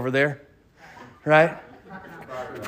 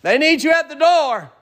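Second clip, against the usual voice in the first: high, raised call into the house; 13 semitones higher, 5 dB louder than usual. Acted. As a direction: announce the visitor. Family member calling Jochebed.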